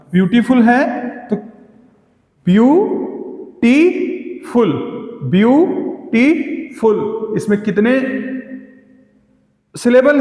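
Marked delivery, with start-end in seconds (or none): 2.5-4.7 beautiful split slowly as view, टी, फुल; three separate beats in beautiful, view and टी rising, फुल falling; syllable by syllable. Shown as a demonstration. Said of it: "इसमें तीन syllable हैं।". 5.2-7.3 beautiful split slowly as view, टी, फुल; three separate beats in beautiful, view and टी rising, फुल falling; syllable by syllable. Shown as a demonstration. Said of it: "इसमें तीन syllable हैं।".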